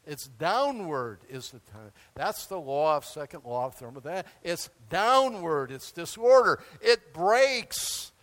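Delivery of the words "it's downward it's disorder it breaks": loud, slow preaching emphasis; emphatic. Real, not acted. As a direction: drive the point home. Preacher hammering the point.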